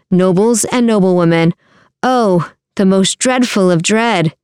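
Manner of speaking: ordinary delivery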